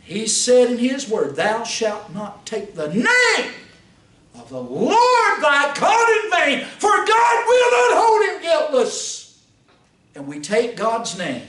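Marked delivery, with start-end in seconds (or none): 1.3-9.4 shouted scripture. Acted. Preacher quoting God's word to church, full-force shout. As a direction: thunder the commandment out at the congregation.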